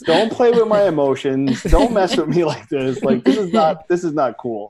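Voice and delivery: hammed-up don't-mess-with-me plea; mock disbelieving outburst. Disbelieving outburst at flattering star.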